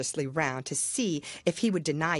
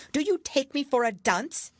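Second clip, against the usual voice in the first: higher, testy royal retort; sharp impatient king; 9 semitones higher than usual. A narrator's reading. King scolding the Hatter, higher and impatient. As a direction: cut him off impatiently. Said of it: "said the king sharply".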